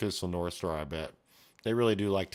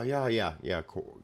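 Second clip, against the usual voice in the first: ordinary talk, nothing marked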